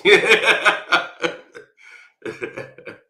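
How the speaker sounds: high, loud peal of laughter; big real laugh; long loud laugh. Real, not acted. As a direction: crack up at your own joke. Laughs after his punchline.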